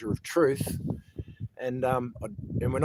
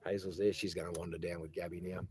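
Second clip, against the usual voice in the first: ordinary talk, nothing marked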